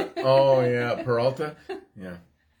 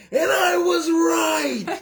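high laughing yell; triumphant shout; 10 semitones higher, 3 dB louder, slower than usual. Real, not acted. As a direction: shout the win with glee.

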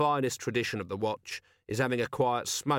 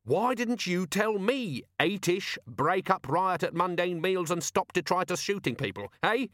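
high-pitched exasperated rant; livid; 9 semitones higher than usual. A narrator's reading. Vimes berating his useless organizer, exasperated rant. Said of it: "that's what drives me livid".

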